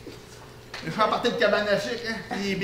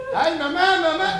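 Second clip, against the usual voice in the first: calling out; 10 semitones higher, 5 dB louder than usual. Acted. Child calling mother in scene.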